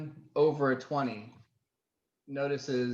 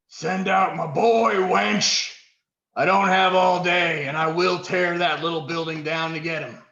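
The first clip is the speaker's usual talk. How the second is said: louder, higher roaring brute; deep gravelly yell; 6 semitones higher, 11 dB louder than usual. A narrator's reading. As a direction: bellow it like a brute. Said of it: "a deep sort of gravelly voice, uh, yells out".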